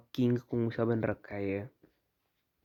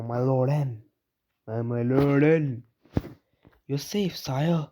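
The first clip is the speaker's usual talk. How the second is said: hammed-up servant; 2 semitones higher, much slower than usual. Voicing a servant to N.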